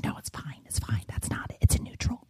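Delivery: hushed, whispered imitation of brushing someone off; dismissive whisper. Wife imitating husband insisting the boat is fine, dismissive whisper. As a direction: mutter it away under your breath.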